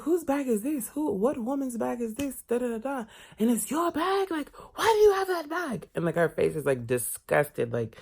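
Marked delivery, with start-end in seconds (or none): 0.0-5.9 scandalized nosy lady. Retold or imitated. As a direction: grill him like a scandalized auntie. Retelling lady's questioning.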